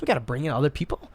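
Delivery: ordinary talk, nothing marked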